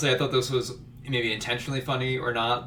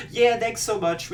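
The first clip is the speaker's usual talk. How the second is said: fake polite; 11 semitones higher than usual. Guests politely escaping.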